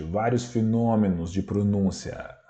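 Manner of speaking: normal talking voice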